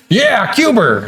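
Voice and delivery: high, insistent "Cuba" ending in an R, "Cuber"; grandma's added R. Retold or imitated. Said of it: "she'd be like".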